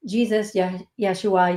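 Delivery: usual speaking voice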